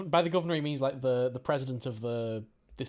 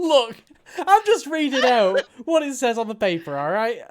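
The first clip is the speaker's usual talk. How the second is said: higher and louder defensive protest; 7 semitones higher, 9 dB louder than usual. Real, not acted. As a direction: insist you are only reading it.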